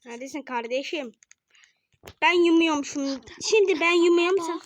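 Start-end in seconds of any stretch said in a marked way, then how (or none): 2.2-4.6 louder, higher insisting; louder, higher insistent demand; insistent call. Real, not acted. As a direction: demand your turn, loud. Insisting on being seeker.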